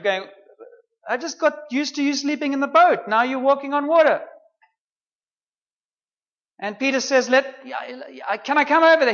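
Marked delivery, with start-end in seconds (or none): 1.1-4.2 high, amazed; a raised, astonished voice; surprised disciple. Retold or imitated. 7.3-9.1 high, eager asking; a raised, keen plea; eager Peter. Retold or imitated.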